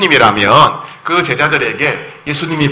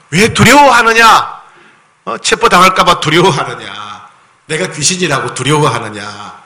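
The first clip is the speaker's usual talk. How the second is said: rebuking Jesus. Acted. Jesus rebuking disciples, voiced.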